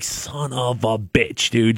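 slow, low, quiet grumbled curse; pissed-off mutter. Retold or imitated. Driver stuck in the snowy lot, a slow grumbled curse. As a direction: grumble it to yourself, dragged out. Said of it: "Just, like, pissed off".

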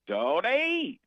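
a smooth announcer's call of the name; Jim Nantz. Retold or imitated. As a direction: Nantz impression, one word. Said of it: "all I really do with Nantz".

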